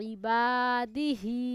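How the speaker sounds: ইবাদিহি read very slowly, the long "বা" held; drawn-out vowel. Shown as a demonstration. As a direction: teach ইবাদিহি syllable by syllable. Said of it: "এক আলিফ টেনে পড়তে হয়".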